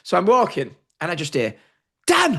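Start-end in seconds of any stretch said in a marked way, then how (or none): 2.1-2.4 high surprised call across a crowd; old friend hailing him across the arena. Retold or imitated. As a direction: shout his name, spotting him from afar. Old mate calls across the arena.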